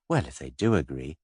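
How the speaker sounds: Antony gently calming the excited detective. A narrator's reading. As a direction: ease it out gently, almost kindly. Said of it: "he said very gently".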